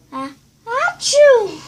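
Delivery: loud high drawn-out sneeze. A narrator's reading. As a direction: ham up a big sneeze.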